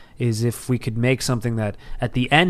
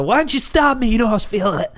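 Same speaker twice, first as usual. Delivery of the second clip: whiny complaint; 8 semitones higher than usual. Retold or imitated. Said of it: "I go".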